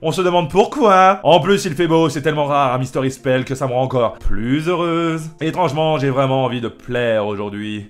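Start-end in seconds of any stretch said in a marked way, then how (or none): none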